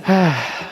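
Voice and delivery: a short tired breath out; tired sigh. Real, not acted. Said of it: "थकने तो मैं लग रहा हूँ पूरा ही". (थकी हुई आह)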